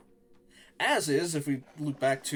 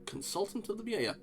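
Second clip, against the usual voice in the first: higher, softer psychic tryout; 7 semitones higher, 4 dB quieter than usual. Pretend. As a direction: test the psychic voice quietly. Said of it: "Let me get a proper psychic voice on".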